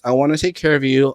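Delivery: usual speaking voice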